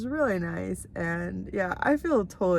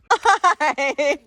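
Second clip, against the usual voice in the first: talking to a dog; 7 semitones higher, 4 dB louder, much slower than usual. Real, not acted. Greeting neighbour's dog.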